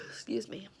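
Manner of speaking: sleepy, yawning through words; tired and yawning. Real, not acted. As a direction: yawn through it. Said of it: "apologize for the yawning".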